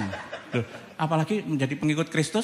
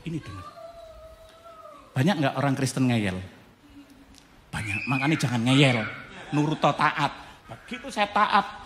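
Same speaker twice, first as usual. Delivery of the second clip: ordinary talk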